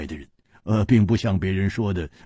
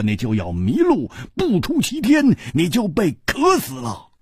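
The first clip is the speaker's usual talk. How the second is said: high-pitched, fast, heated lecturing; excitable hero scolding his friend; blustering sworn brother; 10 semitones higher than usual. Acted. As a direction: rant at him, voice climbing high. Hu Tiehua ranting about the desert.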